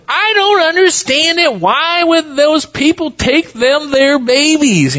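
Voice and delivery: high nasal twang, puzzled and pleading; Festus twang impression. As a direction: do Festus, baffled, through the nose. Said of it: "And so he says".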